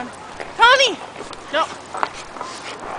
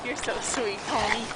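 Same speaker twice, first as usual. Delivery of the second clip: tender; 5 semitones lower, 8 dB quieter than usual. Real, not acted. Tender talk to the dog.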